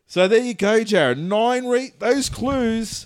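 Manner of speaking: loud, high, triumphant reveal. Real, not acted. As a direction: proudly present the evidence. Triumphant wrap-up to cohost.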